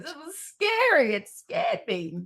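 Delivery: slow mock-crying little voice, starting high; imitated tearful child. Daughter remembering the scary boat, mock tearful child voice. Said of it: "starts crying".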